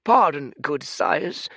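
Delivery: bow with your voice, polite and proper; courtly old man. Acted. Old Fate politely introducing himself to the giant, humble courtly voice. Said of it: "said Fate".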